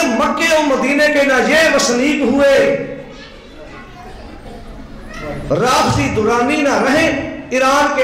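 drawn-out recited verse; slow poem recitation. Retold or imitated. Preacher quoting the poet's couplet, slow chant. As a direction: hold each word in a slow chant. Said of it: "ਕਹਿੰਦੇ".

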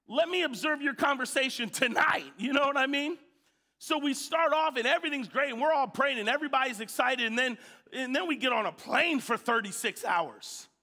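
loud, high, fervent preaching; a preacher at full force, fervent and loud. Acted. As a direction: drive it home to the congregation, building.

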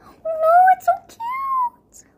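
high whispered squeal of delight. Real, not acted. Vlogger gushing over the mermaid lip gloss, high squeal. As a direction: gasp it out, overwhelmed by cuteness.

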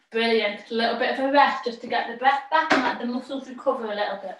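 normal talking voice

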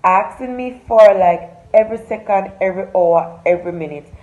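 loud, punchy host greeting to the camera; upbeat vlog intro. Acted. Vlogger welcoming her followers before the story, loud upbeat host voice. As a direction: hype up your followers like a show host.

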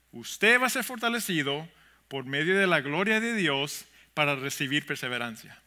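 ordinary talk, nothing marked

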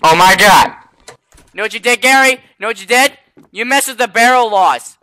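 normal talking voice

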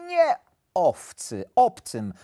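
emphatic contrast, landing firmly on "obcym"; correcting. A narrator's reading. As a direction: set "obcym" against "owcy" as a correction. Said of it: "poprawił go wilk".

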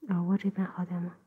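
gentle ear-cleaning whisper. Acted.

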